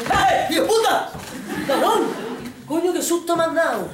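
hammed-up startled outburst; startled fright. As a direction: jump out of your skin, cursing. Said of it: "Vamos a dar un susto".

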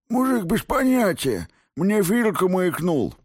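very high, strained lisping voice; lisping injured thug. A narrator's reading. Beaten thug tells interrogator who tipped him off, lisping. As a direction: squeal it through broken teeth.

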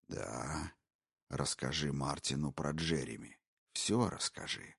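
low, hushed, gloating whisper; a predator savouring every word; menacing intruder. Acted. Intruder taunting the wife, low sinister whisper. Brogan's voice.

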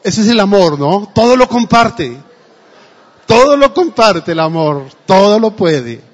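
loud fervent preaching; preaching at full force. Acted. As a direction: proclaim it to the whole church. Preaches on love. (predicando con fuerza, en voz alta)

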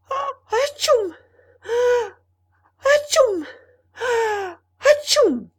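very high, explosive sneezes; huge theatrical sneeze; acted sneezing fit. A narrator's reading. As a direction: burst out in loud repeated atchoos. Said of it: "Et il éternua trois fois".

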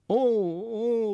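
high, drawn-out exclamation of dismay; dismayed cry. A narrator's reading. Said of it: "rief Felix".

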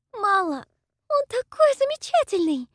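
high, loud excited squeal; squealing with delight. Acted. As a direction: squeal it like a smitten girl. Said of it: "Салина снова запищала от восторга".

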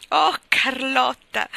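slow, loud, high cry of joy; joyful cry. Acted. Anne after dancing round the kitchen, joyful cry. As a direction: sing it out, overjoyed. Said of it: "gridò tutta allegra".

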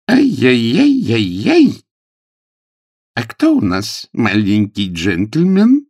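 high, loud, slow sing-song tutting; chiding nanny. Acted. Nanny shames the little gentleman, chiding. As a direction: coo reproachfully as if to a naughty child.

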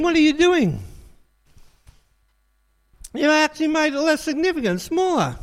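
God rebukes the calf worshippers in exasperation. Acted. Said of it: "God goes".

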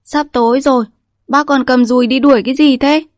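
put-on a village girl questioning an old man; girl asking furtively. A narrator's reading. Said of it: "Cái dần hỏi trộm".

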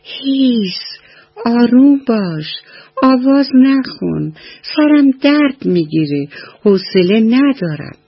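put-on a tired old tree shushing the crow; trembling old tree voice. A narrator's reading. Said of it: "با صدای لرزان گفت".

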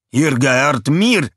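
high, loud, slow villain cry; Russian villain calling out. A narrator's reading. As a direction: bellow it like a menacing Russian villain. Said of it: "rief der Russe". (lauter, langsamer Schurkenruf)

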